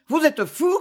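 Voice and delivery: understated angry outburst; shouting. A narrator's reading. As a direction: cry out at him, half-hearted. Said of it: "criait Famose".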